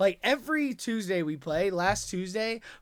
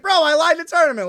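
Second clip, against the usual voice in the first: exasperated protest; 9 semitones higher, 10 dB louder, faster than usual. Retold or imitated. Liar pushing back at the mob.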